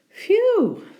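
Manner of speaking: high, loud phew of relief; relieved phew. A narrator's reading. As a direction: sigh of relief aloud.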